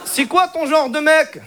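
put-on slow, high Moroccan-accented question; drawn-out comic Moroccan accent; Hicham. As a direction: chat her up in a Moroccan accent. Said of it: "l'accent arabe".

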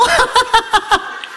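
éclat de rire haut perché; rire aigu. Acted. (éclat de rire aigu et bref)

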